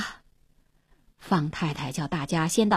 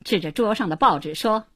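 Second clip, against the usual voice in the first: ordinary delivery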